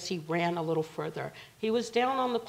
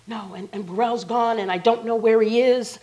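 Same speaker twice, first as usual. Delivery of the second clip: louder, agitated retold outburst; raised, insistent imitation; frantic retelling; 4 semitones higher, 8 dB louder than usual. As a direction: act out someone in a panic. Acting out frantic woman leaving.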